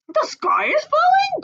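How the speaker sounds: high startled turkey. Acted. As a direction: gobble it out startled. Turkey Lurkey voice.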